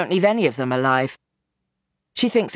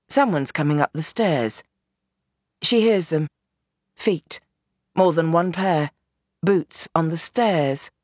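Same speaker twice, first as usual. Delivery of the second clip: plain reading voice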